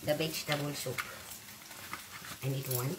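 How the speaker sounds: normal talking voice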